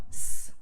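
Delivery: S as a bare hissed "sss"; S sound. Shown as a demonstration. Teacher showing the Latin American sound, hissed S. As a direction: sound out S, not its letter name. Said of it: "would make the sound of".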